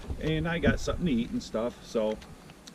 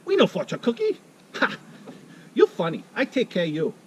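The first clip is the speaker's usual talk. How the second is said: much higher and louder, with a laugh; waitress laughing at his order, Thai accent; mock Thai accent, teasing; 10 semitones higher, 6 dB louder than usual. Waitress mocking his fortune cookie order, in a high, loud mock Thai accent. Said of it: "You go".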